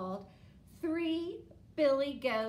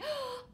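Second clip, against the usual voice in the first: excited gasp. Acted. Excited gasp before story.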